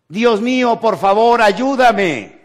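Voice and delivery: loud, high cry for help; desperate shout. Acted. Man hanging off a cliff cries to God, shouting. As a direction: yell up to heaven for rescue. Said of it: "Entonces gritó".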